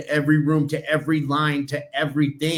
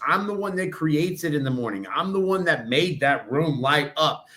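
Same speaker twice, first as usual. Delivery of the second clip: normal talking voice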